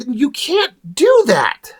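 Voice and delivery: high-pitched, drawn-out disbelief; stretched-out incredulous objection. Retold or imitated. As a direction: protest it slowly, pitched well up. Incredulous reaction to the comic.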